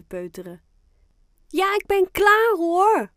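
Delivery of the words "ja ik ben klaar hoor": high, loud, eager shout; calling back. A narrator's reading. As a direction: shout back that you're coming. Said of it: "roept Fien terug". (luid teruggeroepen)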